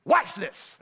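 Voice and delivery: slow, swaggering tease; mock-confident miracle worker; cocky showman Jesus. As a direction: play it up like a show-off. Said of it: "If I were him, I'd be like".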